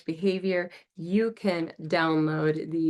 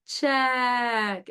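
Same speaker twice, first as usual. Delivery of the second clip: put-on child saying check; 5 semitones higher than usual. Shown as a demonstration. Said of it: "they say".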